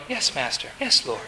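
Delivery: low, soft, submissive reply; outwardly deferential. Acted. Jairus politely answering his master despite himself, deferential. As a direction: answer meekly under your breath. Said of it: "Culturally, he's probably going".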